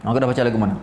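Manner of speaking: normal talking voice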